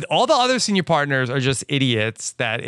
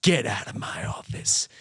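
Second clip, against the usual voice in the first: low stern voice; 4 semitones lower, 6 dB quieter than usual. Retold or imitated. Jessica's angry reply.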